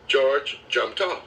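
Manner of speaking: usual speaking voice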